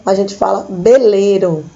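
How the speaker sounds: "beleiro" dito com B no lugar do V; "veleiro" com som de B. Shown as a demonstration. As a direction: troque o V por B: "beleiro". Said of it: "o V tem som de B". (pronúncia espanhola: "beleiro" com B)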